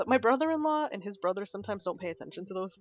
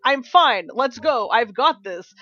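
loud stubborn bravado; a proud hiker insisting she's fine, loud; proud insistence; 3 semitones higher, 8 dB louder, slower than usual. Retold or imitated. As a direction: push through, refusing help loudly. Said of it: "And I was like".

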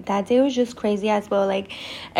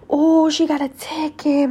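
drawn-out, higher teasing "ooh"; mock taunting; 5 semitones higher than usual. Classmates tease the girl back from police, mock taunting. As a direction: imitate classmates' slow taunt.

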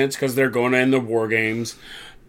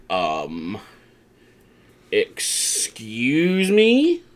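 slow, climbing, hammed-up incredulity; mock disbelief; much slower than usual. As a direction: stretch "excuse me" into an incredulous question. Crowd's disbelief at leader claim.

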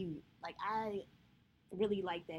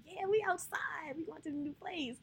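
excited; 8 semitones higher than usual. Retold or imitated. Continuing the U-Haul celebration.

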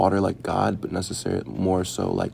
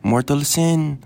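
slow, higher, louder grave grandma; imitating grandma; 7 semitones higher, 5 dB louder, much slower than usual. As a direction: intone mortal sin like a pious Lola. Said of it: "And she was like".

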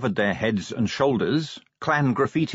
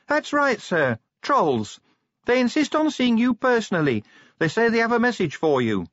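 high, cautious voice down the tube; careful, watchful tone; guarded; 13 semitones higher than usual. Acted. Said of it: "a guarded tone to her voice".

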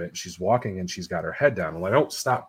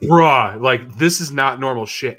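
loud, raised-pitch alarm; freaked-out exclamation; 4 semitones higher, 11 dB louder, slower than usual. Retold or imitated. Storyteller to his buddy about the swing, loudly freaked out. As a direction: blurt it out, acting the freak-out.

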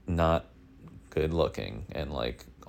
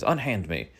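put-on old-timey phrase; 5 semitones higher, 4 dB louder than usual. Shown as a demonstration. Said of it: "A big one is".

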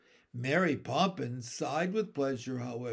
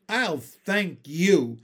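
slow and louder, a cold reproof; reproving; 2 semitones higher, 7 dB louder, much slower than usual. A narrator's reading. Nanny rebuking the boy, cold and slow. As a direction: put him firmly in his place. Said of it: "giving him a terrible glance".

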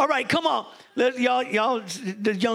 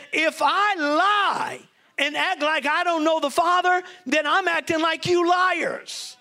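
preached shout; 5 semitones higher than usual. Acted. Preacher voicing Jesus to his accusers, shouted and slow. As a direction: thunder it out at the accusers, slow and high.

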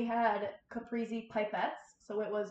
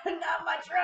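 mock panic; 7 semitones higher, 4 dB louder than usual. Mocking friend's panic.

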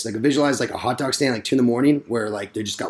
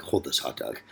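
much lower, much softer command; low aside; 12 semitones lower, 13 dB quieter than usual. Retold or imitated. Handing the hot dog off before a fight, low aside. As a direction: mutter it low and quick. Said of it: "I'm like".

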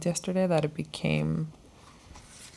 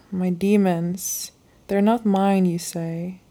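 heartfelt, slow poetic reading, louder than her chat; reading a love letter; 4 dB louder than usual. Acted. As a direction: read it like a love poem to a dear friend. Reads her second journal letter.

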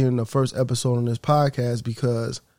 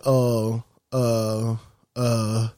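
halting, drawn-out stalling; imitated tongue-tied employee; reluctant hesitation; 3 semitones lower, much slower than usual. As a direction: stall as someone dreading the answer. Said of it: "he starts to cry because he knows".

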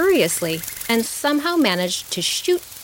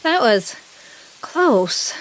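mildly winded, higher than usual; panting; 5 semitones higher, 3 dB louder, much slower than usual. A narrator's reading. Dragonfly escaping the downpour, panting. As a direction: get it out while catching breath. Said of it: "she panted".

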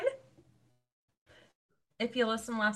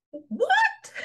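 squeaky, very high surprised what; high-pitched what; 4 dB louder than usual. Retold or imitated. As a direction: squeak it way up in shock. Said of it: "Along with a high-pitched".

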